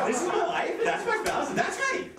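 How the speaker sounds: high, climbing imitation of a happy spouse; mock elated. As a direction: announce it brightly, pitch climbing each phrase. Acts spouse waking from love fog.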